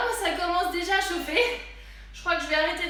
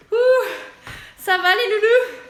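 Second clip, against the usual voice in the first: laughing, louder shout to the class; excited call; 3 semitones higher, 5 dB louder than usual. Real, not acted. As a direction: call out, elated after the set. Loud laughing call after the workout. (appel joyeux et essoufflé)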